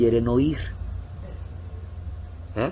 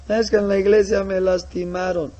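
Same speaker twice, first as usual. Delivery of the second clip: high whimpering grievance; whiny complaint; 7 semitones higher, 3 dB louder than usual. Acted. Hurt brother complains to missionary, high whimper. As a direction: sulk it out through tears.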